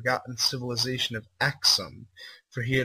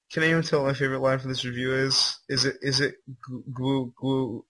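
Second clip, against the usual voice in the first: usual speaking voice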